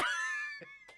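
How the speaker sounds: shrieking laugh; real laughter; high laugh. Real, not acted. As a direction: crack up. Laughs at the death.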